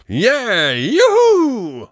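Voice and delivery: high, loud drawn-out cheer; jubilant whoop. A narrator's reading. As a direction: whoop in triumph. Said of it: "jubelte Kunz".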